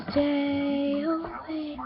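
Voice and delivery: put-on high, very slow, stretched-out child's call; little girl's voice. Ghostly girl calling to the sleepy narrator at night, eerie high drawl. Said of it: "I heard a little girl's voice".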